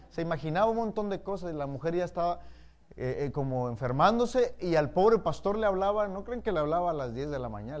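ordinary talk, nothing marked

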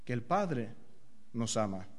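fading tender emphasis. Real, not acted. Soft emphasis on Father's love.

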